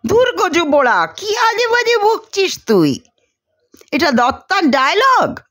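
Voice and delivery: high, incredulous rebuke; stunned scolding. Acted. Sharmila scolds Mahendra, high and incredulous. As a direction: scold him in high disbelief. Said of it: "বিস্ময়ে হাঁ হয়ে যায়".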